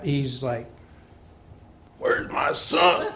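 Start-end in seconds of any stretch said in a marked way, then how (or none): 2.0-3.1 drill-sergeant dad. Retold or imitated.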